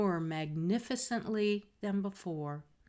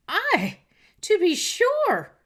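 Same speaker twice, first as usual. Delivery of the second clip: much higher, louder, slow mocking cry; scornful cry; 11 semitones higher, 12 dB louder, slower than usual. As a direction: jeer it out high and loud. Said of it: "cried Miss Charlotte".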